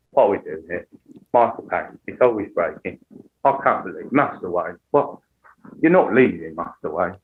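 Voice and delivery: low, trembling English voice; Michael Caine. Acted. Butler pleading with Master Wayne, trembling breaking impression. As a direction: let it keep breaking, low and soft. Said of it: "it's trembling".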